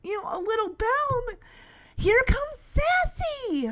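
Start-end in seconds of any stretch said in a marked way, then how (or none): 2.0-3.7 very high, drawn-out glee; thrilled announcement. Real, not acted. Fan announces Sassy's return, high and thrilled. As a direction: sing out the arrival slowly and excitedly. Excitedly relives cat's arrival.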